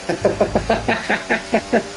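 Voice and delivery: high, soft run of chuckles; real rapid laugh; teasing laugh. Real, not acted. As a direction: giggle at your own teasing. Laughing after teasing his mate.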